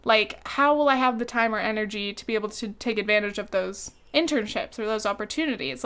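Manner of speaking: usual speaking voice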